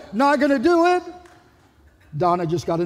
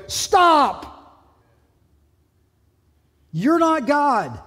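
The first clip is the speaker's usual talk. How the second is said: sharp, higher, stern command; firm rebuke; 6 semitones higher, 4 dB louder, much slower than usual. Real, not acted. Preacher sternly rebukes judgmental listeners, firm command. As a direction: call the judging listener to a halt.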